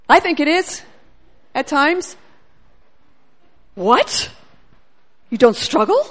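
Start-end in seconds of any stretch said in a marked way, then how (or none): none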